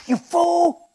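loud, high-pitched scolding; exasperated villain outburst; angry boss. Acted. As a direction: berate a bungling underling. Boss rebukes the imp.

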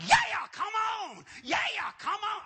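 high, loud hollering, hammed up; wildly excited preacher. Short pastor rallying people forward, excited shouting. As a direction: mimic a fired-up pastor's shout. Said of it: "this guy was so excited".

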